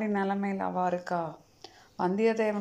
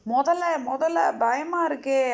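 storyteller's higher, louder cry of alarm; mildly acted panic, raised pitch; frightened cries; 4 semitones higher, 4 dB louder, slower than usual. A narrator's reading. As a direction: voice a scared woman calling out. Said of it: "அவ பயந்துன்னு இருக்கா".

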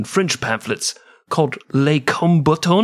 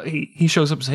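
ordinary delivery

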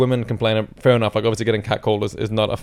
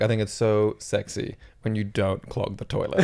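mock seductive; 2 semitones lower, 9 dB quieter, slower than usual. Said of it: "I wanna be like".

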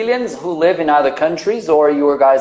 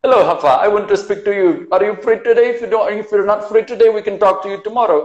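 put-on higher-pitched, quick Indian-accented English; put-on Indian accent, pitched up and hurrying along; 4 semitones higher, much faster than usual. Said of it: "a friend who's from India".

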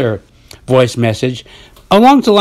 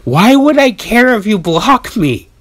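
high retorting voice; 7 semitones higher than usual. Retold or imitated. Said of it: "I respond with my voice".

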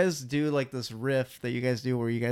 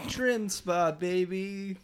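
ad slogan voice; 6 semitones higher, much slower than usual. Pretend. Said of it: "ad slogan quote".

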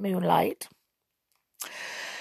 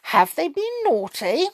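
Queen's voice; 8 semitones higher than usual. A narrator's reading. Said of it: "she said".

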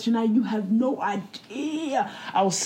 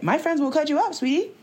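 sassy warning; 8 semitones higher than usual. Retold or imitated. Sassy threat in retold quote.

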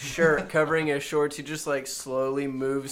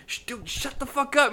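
imitated shushing; 8 semitones higher than usual. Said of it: "He's like".

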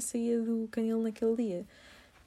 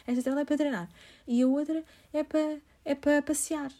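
high, louder chirpy stranger voice; imitating the woman; 6 semitones higher, 6 dB louder than usual. The strange woman explains the leashes, high and chirpy. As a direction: chatter it brightly like the odd woman. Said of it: "ela diz".

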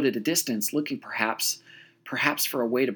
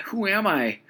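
high despairing cry; 8 semitones higher than usual. Retold or imitated. Peter's doubt cries out while sinking, high. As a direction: throw the question up in despair. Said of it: "cried out".

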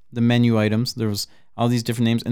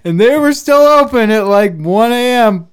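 giddy high; 11 semitones higher, 9 dB louder than usual. Real, not acted. Laughing about the spa.

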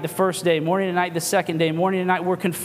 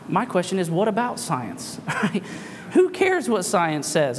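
ordinary talk, nothing marked

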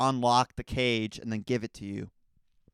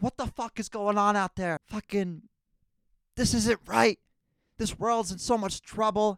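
higher mock rant, half-serious outrage; imitating upset dad; 9 semitones higher than usual. Dad home from work ranting about the world, mock-angry and higher. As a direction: play the fed-up dad venting, pitched higher. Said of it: "He gets upset".

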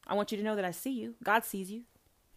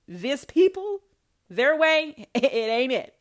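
mocking laugh; 5 semitones higher, 8 dB louder, slower than usual.

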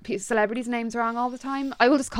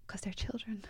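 whispering; 17 dB quieter than usual. Real, not acted. Whispered aside about kids.

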